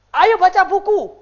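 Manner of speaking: loud, high, drawn-out mock command; nagging parental order. Mother watching TV orders child to read, loud mock nagging. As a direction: call it out like a bossy parent.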